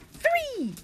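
very high, drawn-out count; playful countdown peak. Pretend. Counting kids into pretend throw, excited high.

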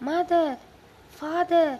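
loud, high call to parents; crying out. A narrator's reading. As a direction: call out urgently for mother and father. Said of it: "she cried".

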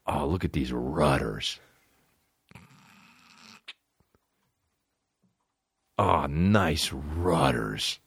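low, soft, very slow leer; mock leering. Guy admiring a woman's chest, low and slow. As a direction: savor it slowly like a creep. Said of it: "you said out loud".

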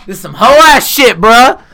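high, loud whiny protest; mock Trump in court. Trump acting crazy in court, high loud whine. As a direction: play the wronged boss, high and loud. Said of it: "Be like".

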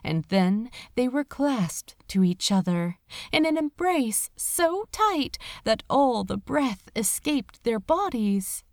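ordinary delivery

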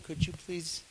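a timid Pharaoh politely asking Abram to go; overly polite. Retold or imitated. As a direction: ask him to leave far too nicely. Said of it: "I bet it wasn't".

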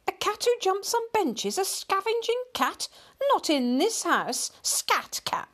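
high-pitched scandalised telling-off; scolding exclamation. A narrator's reading. Mother throwing out the scavenging cat, scolding. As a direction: shoo it out in high indignation. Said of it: "exclaimed the mother".

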